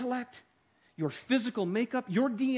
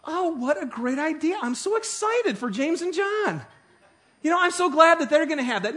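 sarcastic gushing, pitched much higher; higher, hammed-up mock glee; mock excitement; 8 semitones higher, 5 dB louder than usual. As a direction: ham up fake delight for the brothers. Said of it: "would they respond with".

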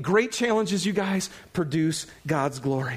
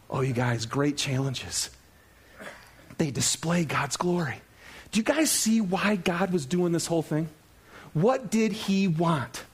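ordinary delivery

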